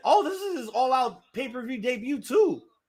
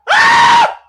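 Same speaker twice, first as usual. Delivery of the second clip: very high, loud yelp of shock; startled scream at the big spot; 16 dB louder than usual. Real, not acted. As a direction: let out a sudden shriek. Reacting to sunset flip.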